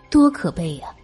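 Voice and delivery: ordinary delivery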